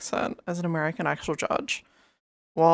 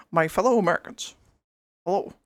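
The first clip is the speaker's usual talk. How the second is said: Obama impression; faster than usual. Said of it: "sound like Barack Obama".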